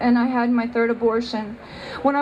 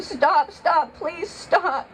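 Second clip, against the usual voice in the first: pleading higher, voice cracking with a sob; retold desperate plea; 5 semitones higher, much slower than usual. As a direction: beg them to stop, half crying. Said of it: "And I screamed".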